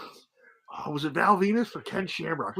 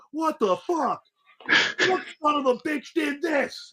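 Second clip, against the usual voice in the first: high, pretend outraged; mock indignation; 9 semitones higher, slower than usual. Owen faking outrage at his own prank, high mock indignation. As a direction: ham up fake shock at the prank. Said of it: "He goes".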